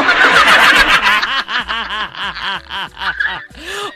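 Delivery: big loud burst of laughter. Real, not acted. Host laughs loudly.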